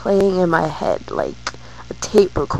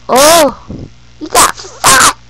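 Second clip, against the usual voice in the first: high, loud grandma exclamation; loud mock surprise, pitched way up; acted sudden recognition; 9 semitones higher, 9 dB louder, slower than usual. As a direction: imitate a grandma blurting it out. Retold grandmother suddenly recognizes son.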